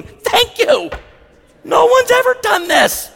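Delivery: high, choked-up thankful woman, laughing through it; tearful grateful Karen. Retold or imitated. Karen thanking the pastor for his apology, high and teary. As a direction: play a weepy Karen thanking him, pitched well up. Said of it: "some of them come up weeping".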